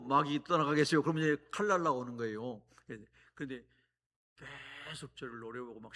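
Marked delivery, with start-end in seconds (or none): 0.0-1.0 openly, out loud. Retold or imitated. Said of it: "대놓고".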